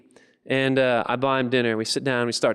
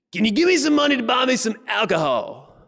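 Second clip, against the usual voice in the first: high character voice, asking outright; begging man; 10 semitones higher, 4 dB louder than usual. Acted. Man at the car wash asks for alcohol money, high begging voice. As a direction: play the alcoholic panhandler, pitched way up.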